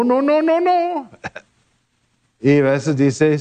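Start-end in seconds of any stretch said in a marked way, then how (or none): 0.0-1.1 playful repeated no, climbing higher each time; God saying no. Pretend. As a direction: tease it like a father gently refusing a child. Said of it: "y dijo". (Dios negando con un no juguetón que sube cada vez más)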